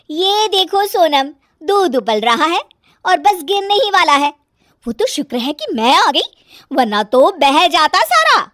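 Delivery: put-on high, loud fault-finding young wife; nagging daughter-in-law, scolding. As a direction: scold over a small mishap, loud and high.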